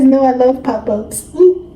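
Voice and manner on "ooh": ordinary talk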